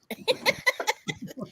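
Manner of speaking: high burst of laughter; real laugh. Real, not acted. Laughing at own Valley Girl bit, high real laugh. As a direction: crack up laughing.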